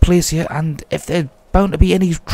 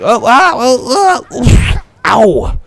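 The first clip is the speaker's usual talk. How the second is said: loud, higher ow and oof, stretched out; pained yelps; 7 semitones higher, 6 dB louder, much slower than usual. Real, not acted. Player falling down the mountain, yelping ow and oof. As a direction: yelp at each hit. Character falls, takes damage.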